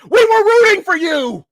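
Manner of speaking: mock Tyra Banks scolding the dog. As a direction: channel the famous furious rant. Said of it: "where the Tyra Banks were?".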